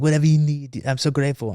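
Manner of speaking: mock-French gratitude, pitched a little up; bad French accent. French reporter pledging help to his rescuer, bad French accent. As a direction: imitate an effusively thankful Frenchman. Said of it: "if it's a bad French accent".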